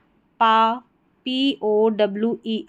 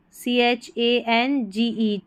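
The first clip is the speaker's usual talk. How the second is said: usual speaking voice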